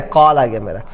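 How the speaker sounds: "कॉल" with open aw, low-pitched; correct aw vowel. Shown as a demonstration. Teacher giving the right form, "कॉल" with aw. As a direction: sound "कॉल" between kaal and kol. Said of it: "बोलते क्या है".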